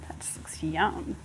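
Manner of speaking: soft, slow, breathy whisper of delight. Real, not acted. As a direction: whisper it like a secret treat. Tasting, admiring the dish.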